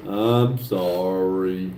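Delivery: slow, low, lightly voiced ghost. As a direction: moan it out like a ghost. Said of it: "don't talk like a ghost".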